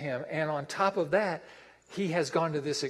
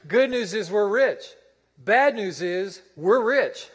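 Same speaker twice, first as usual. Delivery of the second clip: louder, higher preaching refrain; emphatic refrain; 4 semitones higher, 6 dB louder than usual. Shown as a demonstration. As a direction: punch the good news and bad news hard. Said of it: "You remember this now".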